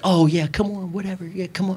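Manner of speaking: high, soft mocking goad; taunting. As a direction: imitate someone egging on a fight. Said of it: "she's sitting there and she's taunting".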